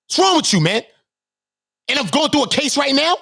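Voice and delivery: real heated indignation; indignant outburst. Real, not acted. Indignant rant at his accusers.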